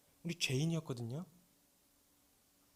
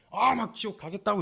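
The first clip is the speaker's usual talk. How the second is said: put-on defiant teen; 5 semitones higher, 5 dB louder than usual. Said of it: "이렇게 얘기하는 순간".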